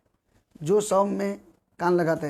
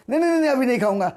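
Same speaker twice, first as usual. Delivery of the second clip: quick refusal; 5 semitones higher, 3 dB louder, much faster than usual. Acted. God refuses second banana.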